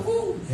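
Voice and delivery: soft, high "fu" of a thrown net; quiet, airy casting sound effect; imitated whoosh. Mimes the net being cast.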